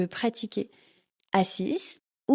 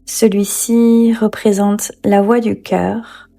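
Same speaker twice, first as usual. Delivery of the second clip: calm, unhurried yoga nidra guidance with long pauses; guided meditation; 9 dB louder than usual. Acted. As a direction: lead them gently into rest, leaving space after each phrase.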